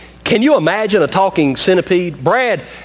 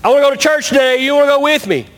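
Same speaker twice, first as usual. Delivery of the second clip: eager invitation; 7 semitones higher, faster than usual. Acted. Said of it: "and he says".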